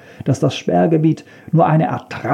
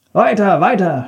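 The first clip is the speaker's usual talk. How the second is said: higher-pitched urging cry; mildly shouted command, pitched up high; narrated shout; 7 semitones higher, 3 dB louder than usual. A narrator's reading. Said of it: "schrie Wollspinner".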